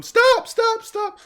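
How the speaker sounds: very high, shrill-pitched cry of stop; pleading shout. Acted. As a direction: break up the fight with a high cry. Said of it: "Stefania gets between the two".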